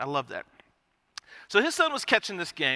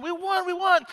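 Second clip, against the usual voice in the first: high, gleeful cheering; mock celebration; 12 semitones higher than usual. Parents celebrating the win, high and gleeful. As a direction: cheer like you won the Series.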